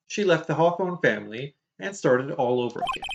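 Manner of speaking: usual speaking voice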